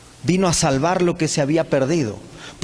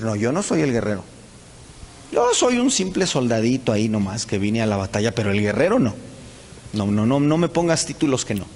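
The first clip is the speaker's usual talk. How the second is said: lower, humble voice of a brother shrinking back; a hint of a timid soldier refusing the title; meek denial; 9 semitones lower than usual. Brothers of David deny being the warrior, lower and timid. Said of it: "Como Juan el Bautista".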